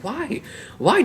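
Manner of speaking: high, soft exasperated why; mock despairing why. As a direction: plead why to the sky.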